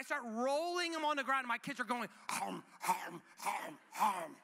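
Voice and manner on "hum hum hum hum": low, rhythmic chomping noises; imitated hippo chomping. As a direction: mimic kids gobbling like toy hippos. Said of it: "the game Hungry Hungry Hippos".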